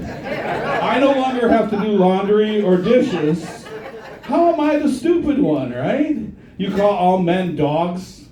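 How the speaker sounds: ordinary talk, nothing marked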